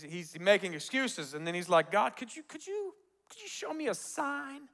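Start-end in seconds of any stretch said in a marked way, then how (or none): 1.9-4.7 small, high, soft pleading voice; timid request. Retold or imitated. Gideon timidly asking God for a sign. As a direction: ask meekly, faltering on the first words.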